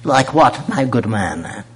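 put-on upper-class English voice; mock toff English reply; pompous British oil man answering his friends; posh British accent. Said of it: "Why are you talking like that?".

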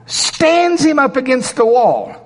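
high, incredulous retelling; dramatic storytelling. Acted. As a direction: act out an outrageous feat. Acting out corpse stood up.

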